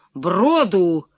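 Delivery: loud, resounding princely command; booming shout. A narrator's reading. Prince repeats his order to find a ford, booming shout. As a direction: boom out the order with a falling end. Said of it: "крикнул князь зычным голосом".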